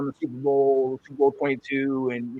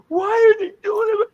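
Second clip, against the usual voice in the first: put-on high, loud kid yelling at the screen; retold yelling; 7 dB louder than usual. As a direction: re-enact a child's outraged yell at the TV. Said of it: "I'm just yelling at my screen".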